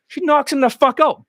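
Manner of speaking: ordinary talk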